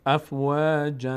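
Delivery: افواجا drawn out slowly; slow Quranic reading; slow recitation. Shown as a demonstration.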